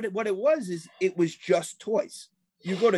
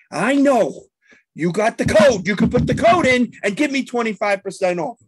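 heated insisting; 5 semitones higher, 7 dB louder than usual. Retold or imitated. Arguing with cashier over coupon.

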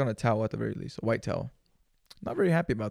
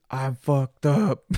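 put-on slurred drunk; 3 semitones higher, 6 dB louder, much slower than usual. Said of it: "the first thing I say".

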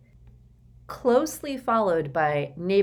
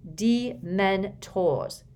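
Dementors drawn out, very slow and distinct; Harry explains the word to his uncle, slowly; slow and clear; 3 semitones higher, much slower than usual. Acted. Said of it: "said Harry slowly and clearly".

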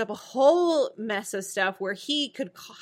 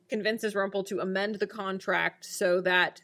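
ordinary talk, nothing marked

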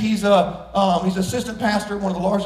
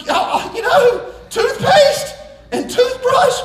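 high, loud, slow disbelief; comic outrage; 13 semitones higher, 5 dB louder, much slower than usual. Real, not acted. Preacher mocking his grandma's gift, shouting slow and high.

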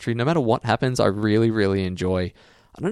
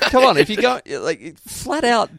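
mock outrage; 11 semitones higher, 4 dB louder, slower than usual. Real, not acted. Mock outrage at listener Jack.

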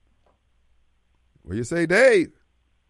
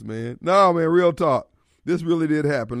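ordinary delivery

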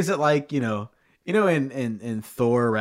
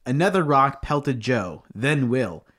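normal talking voice

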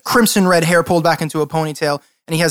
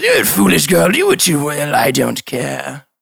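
haughty noble, slowing to a drawl; dismissive lord; slower than usual. Acted. Lord dismisses the guard's plea, scornful. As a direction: brush her off grandly, trailing slower.

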